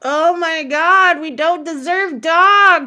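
loud, high, slow cry of feeling; touched outburst. Real, not acted. Host to co-host, overcome by the dog story, loud and high. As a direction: gush over the loyal dog.

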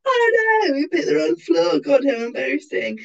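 high, hammed-up dismay; mock embarrassment. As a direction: feign innocent dismay, starting high and easing down. Feigned embarrassment retold to security guards.

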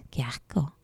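whispered disgust. Acted. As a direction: hiss out the disgust. Girl disgusted.

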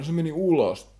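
retold yell. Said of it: "Sitten joku huutaa".

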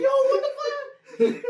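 high, slow, laughing exclaim; laughing disbelief. Real, not acted. Telling friends about the Pope costume fall, laughing in disbelief. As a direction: crack up while you say it.

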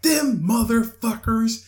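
slow, high drawn-out curse; imitated angry lady. Lady ignoring the bystander's explanation, slow high mock indignation. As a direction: mimic a stranger stretching out her curse indignantly. Lady keeps cursing, retold.

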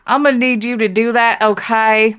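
loud, insistent mock demand; emphatic plea. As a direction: press the point firmly, word by word. Emphatic comic plea to Bill.

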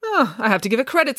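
grudging concession, starting a little high; begrudging. A narrator's reading. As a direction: concede it reluctantly. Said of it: "said Nanny Piggins begrudgingly".

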